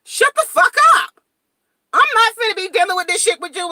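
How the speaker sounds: high, loud, furious snap at a cousin; retold angry yell. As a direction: yell at her to shut up, fully heated. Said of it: "I'm like".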